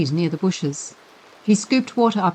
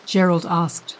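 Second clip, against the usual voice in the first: ordinary delivery, nothing marked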